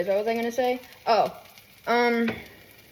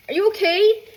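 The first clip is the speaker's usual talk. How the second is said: acted concern; 9 semitones higher, 4 dB louder than usual. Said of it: "I'm walking over, but it's like".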